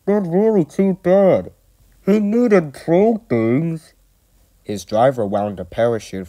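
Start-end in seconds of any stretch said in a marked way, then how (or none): 0.0-3.9 high, loud muffled protest; indignant, mouth-covered squawk. A narrator's reading. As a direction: complain as if smothered under cloth. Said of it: "he exclaimed in a muffled voice".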